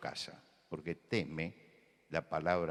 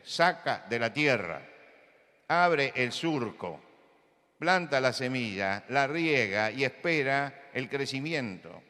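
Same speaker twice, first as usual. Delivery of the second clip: higher, louder, slow preaching; predicación enérgica; 5 semitones higher, 6 dB louder, slower than usual. Acted. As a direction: build the farming image with force. Preaching at full force. (predicación intensa y pausada)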